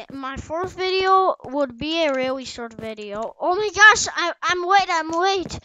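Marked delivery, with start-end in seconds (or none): 3.4-5.6 high, loud flurry of alarm; flustered race against the clock; panicking. Real, not acted. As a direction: blurt it out as time runs out. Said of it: "I'm panicking".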